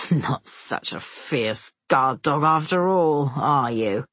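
put-on low, slow drunken taunt; mocking taunt. A narrator's reading. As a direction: sneer at the helpless dog. Drunk guard taunts the dog.

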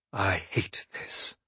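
soft, slow, low confession; quiet. Acted. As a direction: say it under your breath. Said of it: "I said quietly to Amber".

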